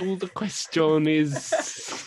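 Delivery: slow put-on Italian accent; mock Italian accent. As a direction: stretch it like a cartoon Italian. Continuing Italian bit.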